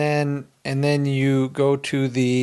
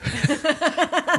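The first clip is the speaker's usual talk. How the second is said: high delighted laugh. Real, not acted. Laughing at prank reveal.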